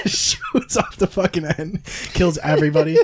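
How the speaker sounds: ordinary talk, nothing marked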